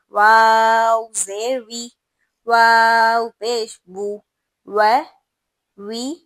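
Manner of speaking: each harakat chanted slowly and loudly, with pauses between; slow, loud Qur'anic spelling drill; slow recitation drill. Shown as a demonstration. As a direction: spell out each vowel mark like a teacher drilling a class. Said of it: "پھر".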